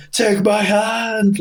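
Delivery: very high, slow, stretched-out call; mock rescue call. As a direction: call it out long into the smoke. Fireman calls into the smoke.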